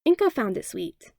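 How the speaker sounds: ordinary delivery, nothing marked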